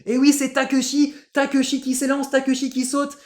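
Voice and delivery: high, loud, breakneck-excited play-by-play; Nelson Montfort. Retold or imitated. Commentator following the jumper's take-off, excited high shout. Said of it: "Il était là, il était là".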